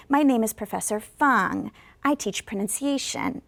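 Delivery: "Fang" and "pronunciation" both fall; falling intonation. Shown as a demonstration. Teacher modelling self-introduction, falling at each end. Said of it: "And it goes down".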